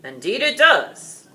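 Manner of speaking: loud, slow dragon voice; dragon reply. A narrator's reading. Dragon answers the knight, loud and slow. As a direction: accept the challenge with relish. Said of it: "Graham replied".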